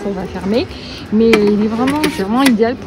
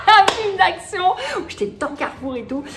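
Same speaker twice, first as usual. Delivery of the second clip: laughing; 8 semitones higher than usual. Real, not acted. Laughing at her own spy story.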